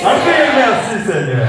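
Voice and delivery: high call, dropping on the last word; rallying preacher. Real, not acted. Preacher leading the church in thanks, high ringing call. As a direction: lead the crowd in a shout. Leads congregation's shout of thanks.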